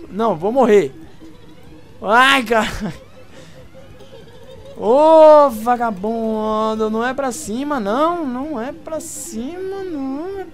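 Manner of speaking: high frantic yelling; panicking gamer. Real, not acted. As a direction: shriek in panic at the game.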